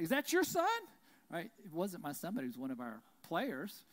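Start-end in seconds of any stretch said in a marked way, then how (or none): 0.0-0.7 shouted high and loud across the stands; a parent demanding, across the bleachers, whether it's your son, yelled loud and high; yelling question. Retold or imitated. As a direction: yell it at the other parent. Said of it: "and he's like".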